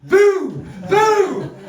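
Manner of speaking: loud, high ghostly boo with a laugh; spooky boo. Acted. Host delivering the spirit's message, a loud laughing boo. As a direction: shout it like the ghost's punchline.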